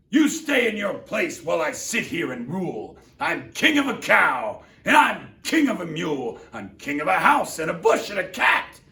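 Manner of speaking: high, loud domineering boast; thundering royal voice. Acted. Yertle thundering at Mac to stay in his place. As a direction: lord it over the little turtle. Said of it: "his royal voice thundered".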